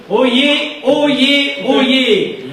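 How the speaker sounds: loud proclamation call; town crier. Acted. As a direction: cry out like a herald.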